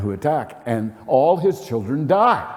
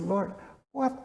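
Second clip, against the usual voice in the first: bewildered lament; 8 semitones higher than usual. Retold or imitated. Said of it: "And I said".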